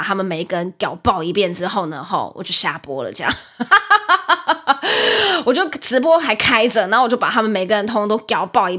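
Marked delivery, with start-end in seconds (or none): 3.4-5.4 high laugh; rueful high laugh. Real, not acted. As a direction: laugh at yourself. Laughing at own story.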